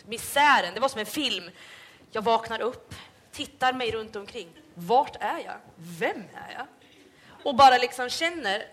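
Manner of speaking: ordinary talk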